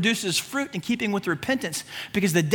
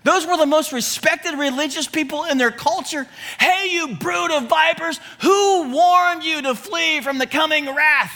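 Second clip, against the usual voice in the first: prophet's rebuke; 8 semitones higher than usual. Retold or imitated. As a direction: thunder it at the religious leaders. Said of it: "This is how he said it".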